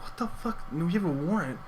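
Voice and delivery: hushed, high-pitched disbelief; a silent freak-out, squeaked under the breath; stunned inner voice. Retold or imitated. Panicked thought about the friend's warrant, hushed and incredulous. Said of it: "Like, in my head".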